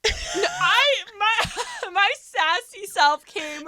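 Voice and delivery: high-pitched, laughing self-defence; laughing protest. Real, not acted. Friend defends her sassy self to teasing co-host, laughing protest. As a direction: protest through giggles, stretching the words.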